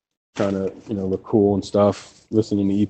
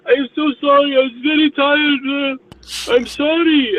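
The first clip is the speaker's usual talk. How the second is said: high-pitched, louder imitation of an Indian accent; hammed-up Indian accent apologizing to the driver he hit; over-the-top Indian-accented apology, much higher; mock Indian accent; 6 dB louder, slower than usual. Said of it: "fucking Indian with his turban".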